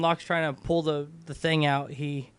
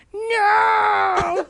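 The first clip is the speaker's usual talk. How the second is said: high, loud cry of no; mock scream; 8 dB louder than usual. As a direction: shriek it in horror. Chick screams at death.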